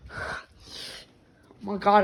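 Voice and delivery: usual speaking voice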